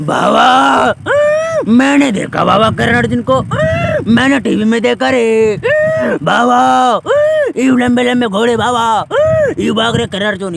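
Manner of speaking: Johnny Lever impression. Comic sidekick answering the villain, high loud yelling. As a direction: yell it like a panicky film comedian. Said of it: "Johnny liver बोलते हैं".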